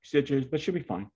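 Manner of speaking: put-on a young man scrambling to reassure his father; flustered rushed answer. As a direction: blurt it all out before he can react.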